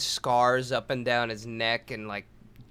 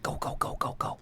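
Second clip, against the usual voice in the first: softer, whispered hurry-up; hushed urging; 9 dB quieter than usual. Retold or imitated. Owner quietly rushing the workers inside. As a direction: urge them on under your breath. Boss hurrying them in secretly.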